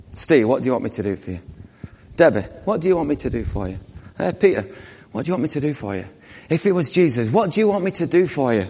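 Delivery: gentle question. Acted. Voicing Jesus asking gently.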